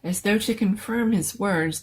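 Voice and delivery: ordinary delivery, nothing marked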